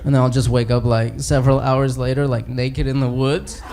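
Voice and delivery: low, halting, embarrassed confession; Hulk. Acted. Hulk admitting his powers to the other heroes, low and sheepish. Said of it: "he's talking to Thor and Captain America".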